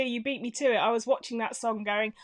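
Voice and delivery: ordinary delivery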